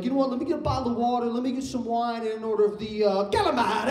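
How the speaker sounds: dad ordering with a lightly put-on Italian accent; fake Italian accent. Dad at a restaurant, mock Italian accent. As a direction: lean on an Italian accent for the food words. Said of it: "pronounces Italian words with an accent".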